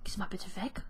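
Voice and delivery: whispered plea. Real, not acted. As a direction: hush it, unvoiced. Asking rival to leave. (geflüsterte Bitte)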